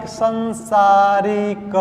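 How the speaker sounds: संसारिक sung slowly; sing-song slow. Shown as a demonstration. Teacher to class, chanting the wrong form. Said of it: "ठीक, संसारिक".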